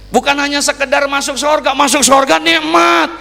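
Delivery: high-pitched, rising emphasis; impassioned preaching. Real, not acted. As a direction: lift the voice to drive it home.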